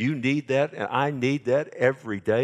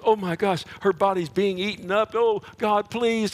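higher and louder, frantic begging; Aaron's panicked plea; 8 semitones higher, 3 dB louder than usual. Retold or imitated. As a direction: cry out in alarm for his sister. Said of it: "Aaron goes".

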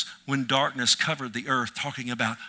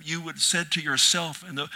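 usual speaking voice

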